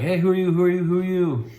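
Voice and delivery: high, urgent repeated challenge; mock-challenge the stranger at the gate; soldiers' challenge. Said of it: "they're like".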